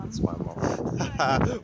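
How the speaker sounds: drawn-out mimic of friend; slow mocking echo. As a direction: echo her slowly, mocking.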